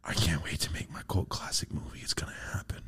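very soft, whispered private vow; dreamy inner whisper. Retold or imitated. Tripping man picturing his cult classic movie, whispering to himself. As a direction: whisper your big hope to yourself.

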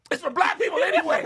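loud high yell. Retold or imitated. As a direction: shout it across the room. Retold yelling in sketch.

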